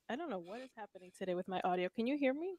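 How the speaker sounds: faint, distant audio; mic cutting out, very faint; barely audible. Real, not acted. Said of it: "you sound a little faint".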